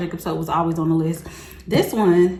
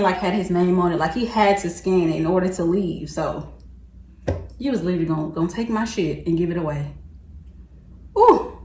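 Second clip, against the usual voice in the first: usual speaking voice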